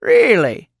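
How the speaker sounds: sly, loud, high "really"; mock doubt, needling playfully; old man's knowing tease; teasing. A narrator's reading. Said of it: "the old man teased with a sly look".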